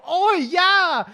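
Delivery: high, shouted mock-Aussie yes; fake Australian accent. Guy claiming to be Australian, shouted fake accent. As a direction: bellow a hammed-up Australian reply. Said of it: "I love Australian accents".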